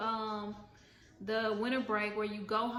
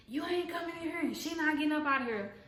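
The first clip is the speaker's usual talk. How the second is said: defiant warning; 5 semitones higher, faster than usual. Retold or imitated. Blocking the door, defiant.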